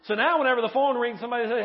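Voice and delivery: ordinary delivery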